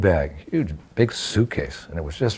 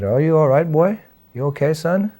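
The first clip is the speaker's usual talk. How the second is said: a higher, kindly driver voice; concerned cabbie; 7 semitones higher than usual. Retold or imitated. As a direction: ask the soaked boy if he's all right. Retold taxi driver asks about him.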